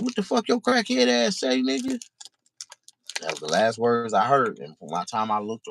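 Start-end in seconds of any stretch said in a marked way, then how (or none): 0.0-2.0 much higher, hostile snarl of a question; menacing challenge. Retold or imitated. Doobie threatening the crackhead, hostile and high. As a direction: square up and dare him to repeat it.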